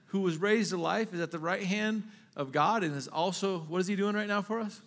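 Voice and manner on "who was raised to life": ordinary talk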